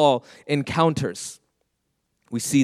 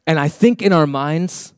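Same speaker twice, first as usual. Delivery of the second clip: usual speaking voice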